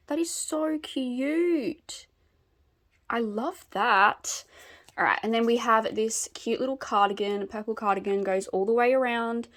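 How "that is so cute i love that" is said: gushing. Real, not acted. Gushing over tattoo detail.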